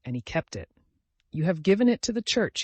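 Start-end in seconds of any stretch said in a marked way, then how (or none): 1.3-2.6 higher-pitched declaration; declaring. A narrator's reading. Old man quotes what another man claimed, declaring. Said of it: "he declared".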